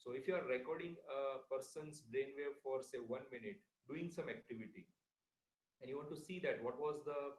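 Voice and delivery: faint, distant microphone; barely audible. Real, not acted. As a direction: voice fading far away. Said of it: "Your voice is very low".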